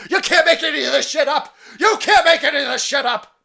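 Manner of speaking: loud, high, laughing outburst; laughing disbelief. Real, not acted. Reacting to the absurd museum detail, loud laughing disbelief. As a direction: crack up in disbelief.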